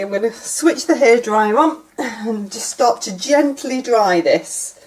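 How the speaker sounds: ordinary talk, nothing marked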